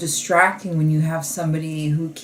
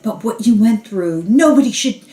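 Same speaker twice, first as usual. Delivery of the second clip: imitating someone getting all worked up, pitch climbing high; mock overwrought outrage, louder and higher; 6 semitones higher, 6 dB louder than usual. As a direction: act out a performative, escalating fuss. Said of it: "getting all worked up".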